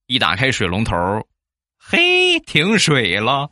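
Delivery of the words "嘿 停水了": a sudden high "hey", then a slow laughing reveal; surprised discovery. Retold or imitated. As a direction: land the punchline with surprise. Discovers the water is off.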